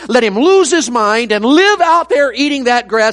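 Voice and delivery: high, loud pulpit cry, slow at times; full-force preaching. Acted. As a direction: preach it out to the back pew.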